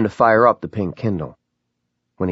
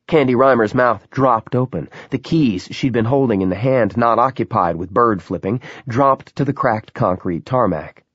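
ordinary delivery, nothing marked

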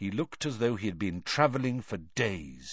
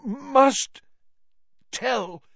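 halting, much higher strained words; terrible effort; 12 semitones higher, 7 dB louder, much slower than usual. Acted. Crouch strains to speak to Harry, halting. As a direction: wrench each word out. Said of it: "cost him a terrible effort".

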